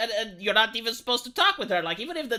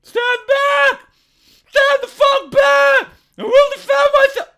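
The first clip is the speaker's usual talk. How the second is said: screamed much higher and louder, slowed; mock hysterical shout; 14 dB louder, slower than usual. Commentator parodies the woman yelling at the man, shrieking. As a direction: bellow it like a panicked, over-the-top defender.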